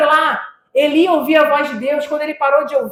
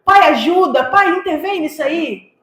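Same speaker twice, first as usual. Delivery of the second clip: a climbing, near-shouting pulpit cry; fervent preaching; 5 semitones higher, 5 dB louder than usual. Acted. Pastor pleading for the crying believer, full-force preaching.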